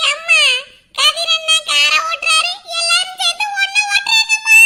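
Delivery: high, loud protesting whine; loud whiny complaint. Acted. Teased girl protests loudly to her mother, whining. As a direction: call out to mom, half-laughing in protest.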